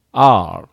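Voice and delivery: R as a long "ar" without final u; one drawn-out English syllable for R. Shown as a demonstration. Teacher modelling the letter, R in English pronunciation. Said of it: "Rではないですよ".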